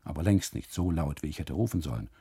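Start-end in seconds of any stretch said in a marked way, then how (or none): none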